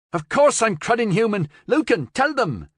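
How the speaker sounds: high, loud outraged protest; indignant protest. Acted. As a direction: fire back, insulted. Florian protests he's human.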